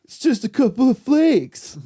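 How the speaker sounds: very high, loud put-on voice; hammed-up high-pitched protest; mock exclamation. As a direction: squeal it out as a joke. Mock outburst about the snow.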